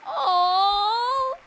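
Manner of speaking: high, loud, teary aw; a choked-up, crying aw; emotional aw. Real, not acted. Streamer welling up at the credit, loud teary aw. Streamer touched, near tears.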